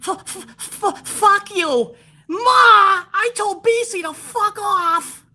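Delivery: put-on very high, childish whine; whiny kid troll. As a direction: whine it like a brat bragging to his mom. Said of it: "with your grubby Dorito paws and go".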